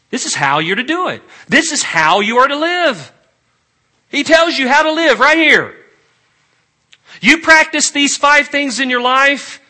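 loud, high, ringing exhortation; full-force preaching. Real, not acted. Preacher telling congregation how they are to live, full-force preaching. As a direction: drive each phrase out loud and high.

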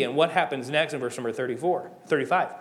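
ordinary talk, nothing marked

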